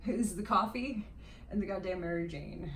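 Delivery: ordinary delivery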